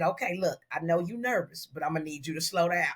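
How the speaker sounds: low, measured imitation; client's calm warning. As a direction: quote him low, reining someone in. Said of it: "He, he got up".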